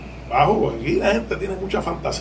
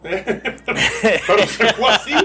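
laughing; 5 semitones higher, much slower than usual. Real, not acted. Laughing at own courtroom slip.

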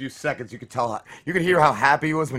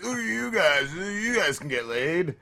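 mocking voice; 5 semitones higher, slower than usual. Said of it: "he's like".

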